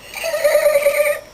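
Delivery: very high chittering squeal; dolphin squeak. Pretend. Playing animal noises as a dolphin, squeaking high. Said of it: "I'm a dolphin".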